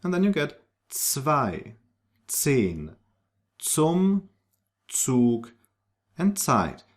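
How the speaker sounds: zwei, zehn, zum, Zug, Zeit with a crisp ts at the start; German z as ts. Shown as a demonstration. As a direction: start each word with a sharp ts. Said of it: "take this sound to the beginning".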